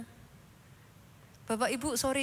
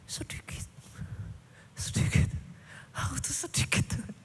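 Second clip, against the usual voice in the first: mock whisper; 4 dB quieter than usual. Mocking believer's self-pity.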